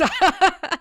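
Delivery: a burst of high laughter; loud laugh. Real, not acted. Laughs at Dallas popping out.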